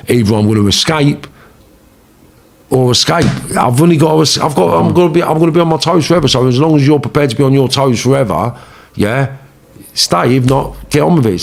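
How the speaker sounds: high-pitched, insistent retold argument; urgent ultimatum. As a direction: lay down the terms urgently. Acted ultimatum to partner about escaping.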